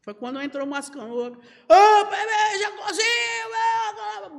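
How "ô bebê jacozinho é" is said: high-pitched, full-on yell; noisy shouted imitation. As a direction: yell it out for everyone to turn and look. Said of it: "barulho todo". (gritaria imitada, bem aguda)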